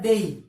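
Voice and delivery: डेई with a gliding e-i ending; correct version. Shown as a demonstration. Teacher models the correct diphthong for exam students. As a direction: stretch डेई into a clear diphthong. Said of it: "क्या होता है?".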